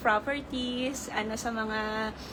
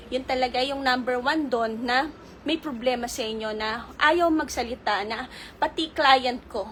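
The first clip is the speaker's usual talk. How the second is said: ordinary delivery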